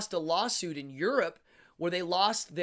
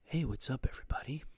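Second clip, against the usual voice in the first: breathy whispered greeting; 4 semitones lower, 3 dB quieter, faster than usual. Shown as a demonstration. Streamer tests his microphone with a whisper. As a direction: whisper it into the new mic.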